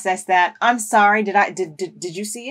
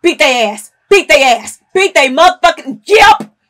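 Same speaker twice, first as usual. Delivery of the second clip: loud chant, climbing in pitch; angry egging on; 4 semitones higher, 8 dB louder than usual. Real, not acted. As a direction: urge them on, louder each time. Furious cheering on a beating.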